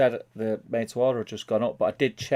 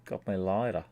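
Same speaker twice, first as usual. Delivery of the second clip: put-on Australian accent; 3 semitones lower, 4 dB quieter than usual. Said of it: "he's doing an Australian accent".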